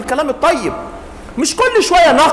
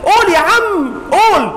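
urging, pitched up; egging on; 5 semitones higher, slower than usual. Real, not acted. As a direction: cheer him on. Urging the listener.

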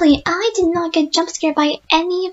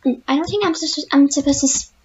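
usual speaking voice

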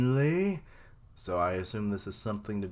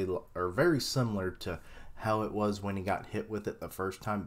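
usual speaking voice

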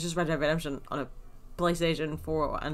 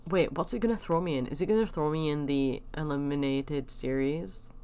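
ordinary talk, nothing marked

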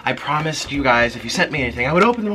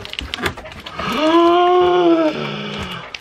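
long high gasp of delight; high drawn gasp; 13 semitones higher than usual. Real, not acted. Opening a fan's gift, a long high gasp.

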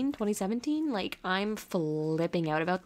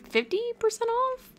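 squealing much higher in disbelief; excited squeal; 14 semitones higher, 3 dB louder than usual. Real, not acted. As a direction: shriek it with delight. Excited about sale.

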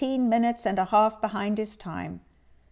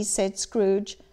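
ordinary delivery, nothing marked

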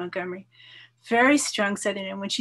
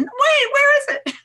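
much higher, laughing fake alarm; mock panic; 9 dB louder than usual. Retelling lost luggage to listener, laughing mock panic. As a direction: squeal it like you just noticed the bags are gone. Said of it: "and I freaked out".

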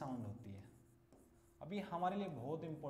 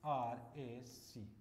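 spelling out slowly; 4 semitones lower, much slower than usual. Shown as a demonstration. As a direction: spell out letter by letter, very slow. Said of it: "और एक लिख दिया". (अक्षर-अक्षर बहुत धीरे बोलना)

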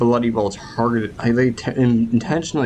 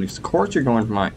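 ordinary delivery

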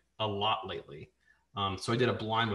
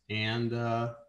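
normal talking voice

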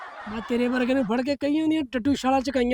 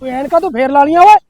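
scolding shout; 9 dB louder than usual. Acted. Cop scolds rider about glasses.

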